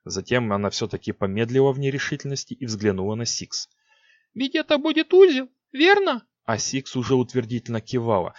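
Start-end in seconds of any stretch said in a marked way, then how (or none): 4.3-6.3 put-on very high, loud unsure girl; hesitant young queen asks. A narrator's reading.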